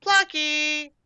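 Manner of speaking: high sing-out call for a cat. A narrator's reading. Daisy searching for the lost cat, calling out. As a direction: call the name on high, held notes. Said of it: "she began to call".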